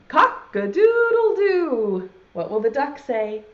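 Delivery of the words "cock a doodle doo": loud, high, drawn-out crow; rooster crow. Acted. Teacher leading children in the rooster's call, crowing. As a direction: crow it slowly and loudly like a rooster. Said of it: "then everyone has to say".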